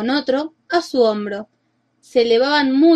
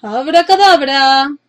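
shouted spell; 5 dB louder, slower than usual. A narrator's reading. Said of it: "la agitó y gritó".

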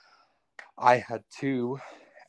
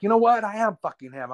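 daring the brother to judge him; defiant comeback; 10 semitones higher than usual. Retold or imitated. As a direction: fling it back with a dare in the voice.

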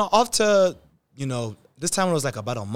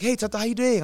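higher, bright mock-cheery greeting; lightly voiced chirpy doctor; 9 semitones higher than usual. Doctor greeting nervous patient, lightly mock-cheery. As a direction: greet him like a doctor in a good mood.

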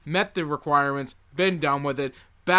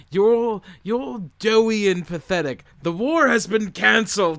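mocking Hook; 5 semitones higher, 4 dB louder than usual. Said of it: "it's really fun that it's like".

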